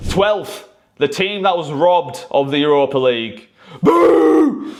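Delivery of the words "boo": a big high jeering boo; loud boo. Real, not acted. Fan booing the Europa League decision, loud high boo. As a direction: boo loudly at the powers that be. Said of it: "I'm booing".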